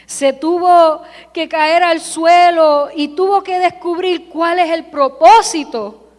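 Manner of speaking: normal talking voice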